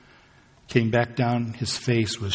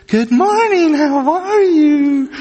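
much higher, louder, sing-song drive-thru greeting; chirpy barista; 8 dB louder, slower than usual. Retold or imitated. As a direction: chirp it like a perky barista over the speaker. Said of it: "she comes on, the little speaker".

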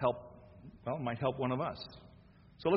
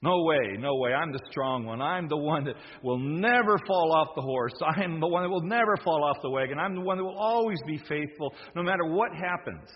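louder, cocky bragging; arrogant boast; 4 semitones higher, 5 dB louder than usual. Acted. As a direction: puff yourself up as the strong one. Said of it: "arrogant like Peter".

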